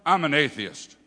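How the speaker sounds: gruff. Retold or imitated. Old man tells preachers what he is, gruffly. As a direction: state it bluntly, a touch gruff. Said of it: "he said very gruffly".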